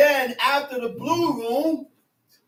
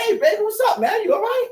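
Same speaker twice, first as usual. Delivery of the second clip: higher, gentle concern for the wife; kind greeting; 8 semitones higher, 3 dB louder, faster than usual. Retold or imitated. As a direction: sound caring as she rushes down. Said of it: "You say".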